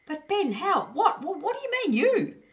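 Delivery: very high, loud astonished hedgehog; amazed squeak. A narrator's reading. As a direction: stammer in sheer disbelief. Astonished hedgehog sees the fire.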